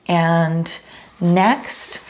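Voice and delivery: normal talking voice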